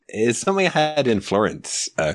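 ordinary delivery

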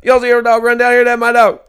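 no spaces, all one word; imitating the woman's frantic rushed question; fast frantic voice. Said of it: "She said".

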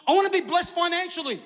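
very high, loud hammed-up wish; over-eager believer, high and loud; mock eager declaration. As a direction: proclaim a big dream at the top of the voice. Acts out grand wish before deflating.

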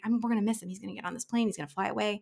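plain reading voice

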